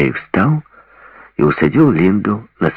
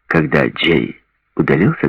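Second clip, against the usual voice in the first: ordinary delivery, nothing marked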